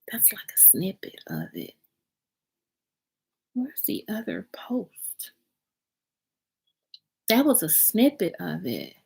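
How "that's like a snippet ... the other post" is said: barely-voiced muttering under breath; whispered aside. Real, not acted. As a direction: whisper to yourself while searching. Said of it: "muttering to self while scrolling".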